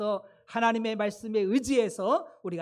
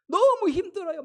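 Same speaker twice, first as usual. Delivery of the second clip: higher, louder acted cry of exhaustion; exasperated plea; 9 semitones higher, 8 dB louder than usual. Moses begs God over the troublesome people, acted exasperated plea. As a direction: plead as a worn-out leader at his limit.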